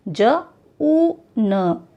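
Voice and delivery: ज, ऊ, न drawn out one by one; sounded out very slowly. Shown as a demonstration. Teacher to children, sounding out very slowly. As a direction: stretch जून into separate sounds. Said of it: "ऊ का उच्चारण बड़ा होता है".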